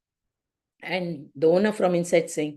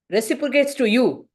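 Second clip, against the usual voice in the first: ordinary delivery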